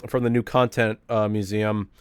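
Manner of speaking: ordinary delivery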